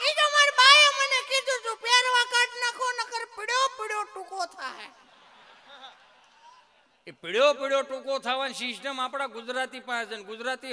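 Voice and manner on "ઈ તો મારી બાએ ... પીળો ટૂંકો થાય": put-on much higher, louder kid voice, slowing; innocent child. (ઊંચો, નિર્દોષ બાળકનો અવાજ)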